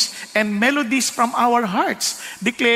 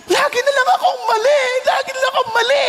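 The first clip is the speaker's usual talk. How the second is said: crying shout; 13 semitones higher, faster than usual. Retold or imitated. Frustrated man wailing to his friend, crying shout. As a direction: cry it out as if always wrong.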